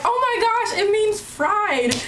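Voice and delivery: high-pitched retold astonishment; sudden realization. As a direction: act out a friend's amazed discovery. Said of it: "the funniest thing when she was like".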